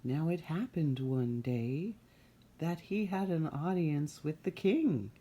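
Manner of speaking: ordinary delivery, nothing marked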